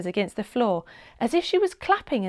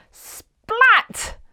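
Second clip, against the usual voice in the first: loud splat sound; 13 semitones higher, 8 dB louder than usual. A narrator's reading. Turtle lands on floor.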